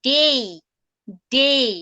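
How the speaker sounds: "day" said as a clear diphthong, ending in i; model pronunciation. Shown as a demonstration. Teacher modelling day for her English class. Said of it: "ডেটা কিভাবে উচ্চারণ করা হয়".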